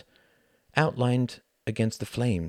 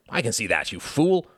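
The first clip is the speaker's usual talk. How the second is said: irritated retort, jumping up high at the end; snapping; 4 semitones higher, 4 dB louder than usual. A narrator's reading. Quentin to the cab driver who stated the obvious, snapping. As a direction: snap at him, punching the insult. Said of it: "snapped Quentin".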